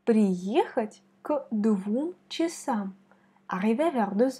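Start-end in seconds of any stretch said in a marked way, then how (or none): 0.0-2.9 Приехать к двум часам said very slowly with stress on приЕхать; slow careful demo. Shown as a demonstration. Teacher slowly reads the example for student. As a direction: dictation pace, every syllable clear. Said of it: "On met l'accent sur Y".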